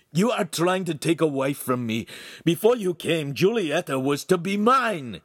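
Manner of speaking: jealous outburst; angry shout. A narrator's reading. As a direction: lay claim furiously, blood boiling. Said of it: "he shouted".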